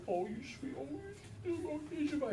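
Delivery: put-on high baby-talk cooing; cooing adult. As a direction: coo at the baby sweetly.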